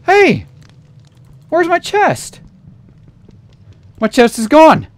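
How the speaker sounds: high, loud outcry of alarm; alarmed shout. Real, not acted. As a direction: shout as you discover the chest is gone. Finds chest stolen.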